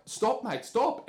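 high, pleading mock cry; lightly acted alarmed plea. Retold guy pleading.

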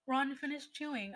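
ordinary delivery, nothing marked

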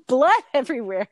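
exasperated laugh in the words. Real, not acted. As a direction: laugh your way through the disaster. Laughs retelling family disaster.

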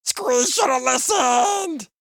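very high, drawn-out loud screech; slow, piercing panicked goblin wail; goblin shriek. A narrator's reading. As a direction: shriek it in terror as a little goblin. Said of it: "The little goblin shrieked".

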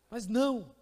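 ordinary talk

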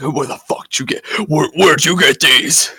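1 imitating an angry dean. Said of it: "he's like".

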